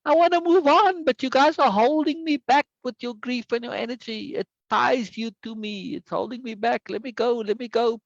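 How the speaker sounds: playing his own spirit begging to be let go; pleading spirit. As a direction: beg them to let you go. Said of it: "And I'm like".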